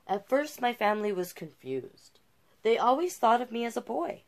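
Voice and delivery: bright, lively read-aloud listing hobbies; picture book narration. A narrator's reading. Reader shares Jazz's hobbies, lively storybook narration.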